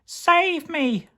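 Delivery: very high desperate "Save me!"; crying out. Acted. Carrot crying for rescue, very high plea. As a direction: cry out for help. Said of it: "cried Carrot".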